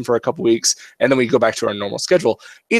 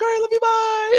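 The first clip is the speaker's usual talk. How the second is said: much higher, sing-song bye; light cheery sign-off; quick goodbye. Retold or imitated. Dad saying goodnight, high sing-song. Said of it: "I'm like".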